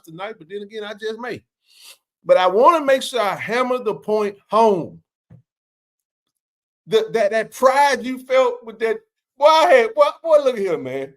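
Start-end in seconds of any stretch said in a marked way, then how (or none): 2.2-11.1 hammering it home. Real, not acted.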